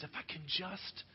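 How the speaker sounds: soft, slow hopeful thought; inner thought. Retold or imitated. Desperate woman thinking of touching Jesus' clothes, quiet and slow. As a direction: whisper it to yourself. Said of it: "she thinks this".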